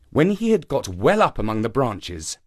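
ordinary delivery, nothing marked

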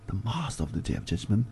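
a hushed, reflective repeat of the line; soft echo. Shown as a demonstration. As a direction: let the repeat fall away quietly. Translation repeated, second softer.

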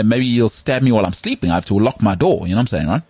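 ordinary talk, nothing marked

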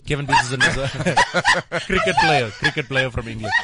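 giggling through the words; laughing. Real, not acted. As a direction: laugh while explaining.

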